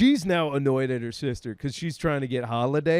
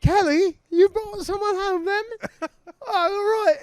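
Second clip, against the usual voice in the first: high, chirpy mum voice; mom's voice. Retold or imitated. Mum teasing Kelly about her guest, high and chirpy. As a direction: tease like a pleased mum.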